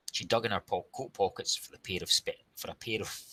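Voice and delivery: tripping over the words; stumbling. Real, not acted. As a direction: fumble the line and break off. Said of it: "We'll start this sentence again".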